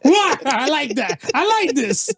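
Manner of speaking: high-pitched, laughing delight; cracked up and thrilled. Real, not acted. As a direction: talk through a big laugh. Cracking up at their differing answers.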